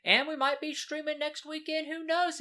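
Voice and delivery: sudden jump way up, sing-song; high sing-song voice. Real, not acted. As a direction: lilt it high and playful.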